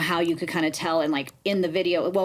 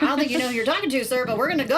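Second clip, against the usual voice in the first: higher, quick-fired challenge; retold indignation; 7 semitones higher, faster than usual. Woman retelling her answer to a fake officer, indignant challenge. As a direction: replay putting a caller in his place, pitched up. Retells defiance to scam caller.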